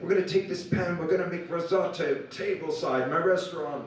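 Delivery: mock enthusiastic chef pitching; imitating enthusiasm. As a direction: sell the idea with gusto.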